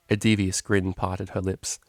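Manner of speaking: plain reading voice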